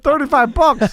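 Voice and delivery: a high, put-on pitch for the price; mock salesman. Host mocks the prank's cost in a put-on voice. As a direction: toss off the price in a silly voice.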